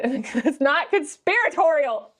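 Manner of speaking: high, drawn-out, laughing protest. Real, not acted. Reader cracking up at own baritone attempt, real laughter. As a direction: break up mid-performance, pitch climbing. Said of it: "Why am I laughing?".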